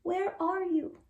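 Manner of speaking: put-on high, small, lost baby voice; frightened squeak. Baby bat calling for her mother, high frightened squeak. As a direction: call out like a lost little bat. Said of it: "Stella Luna squeaked".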